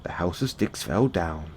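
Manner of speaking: usual speaking voice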